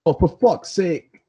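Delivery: imitated Northern English accent. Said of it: "love anyone from Northern England to say".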